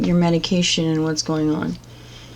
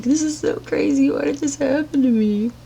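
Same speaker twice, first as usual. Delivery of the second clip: much higher, whiny mock sob voice; mocking someone crying over their own doing, voice pitched way up; mock crying victim; 9 semitones higher than usual. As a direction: play the self-pitying crier, hammed up. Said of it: "and they're like".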